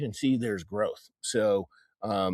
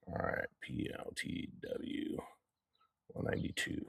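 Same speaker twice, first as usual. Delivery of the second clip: whispering; 15 dB quieter than usual. Real, not acted. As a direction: whisper the numbers to yourself. Muttering while writing figures down.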